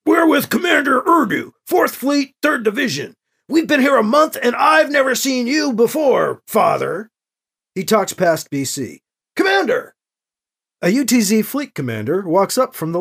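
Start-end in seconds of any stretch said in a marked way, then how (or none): 0.1-7.1 high, shouted military indignation; angry demand. A narrator's reading. Soldier falling back on defensive anger at the priest, angry shouting. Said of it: "and angry demands". 9.4-9.9 high-pitched soldier's call. A narrator's reading. Soldier talking past the priest to his commander, calling out.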